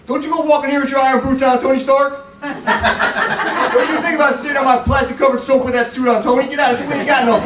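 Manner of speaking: high-pitched sassy scolding; sassy, high-voiced telling-off at home; sassy woman.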